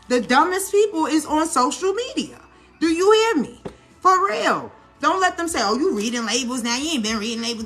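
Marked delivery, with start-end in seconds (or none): 5.6-7.7 mocking naysayers. Mimics naysayers nagging about labels.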